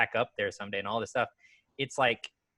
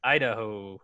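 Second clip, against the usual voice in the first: snooty waiter. Retold or imitated. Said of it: "He goes".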